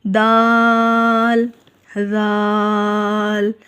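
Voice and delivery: दाल stretched very long on the आ; long drawn daal. Shown as a demonstration. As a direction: hold दाल as long as जीम. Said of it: "ऊपर देखें मद है". (दाल को लंबा खींचकर)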